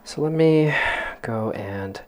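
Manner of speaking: talking through a yawn. Real, not acted. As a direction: stretch the words mid-yawn. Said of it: "Sorry, I keep yawning".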